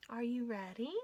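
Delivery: usual speaking voice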